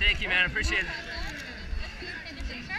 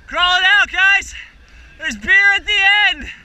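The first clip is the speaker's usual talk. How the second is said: cheering on; 5 semitones higher, 5 dB louder than usual. Real, not acted. Cheering runners toward the finish.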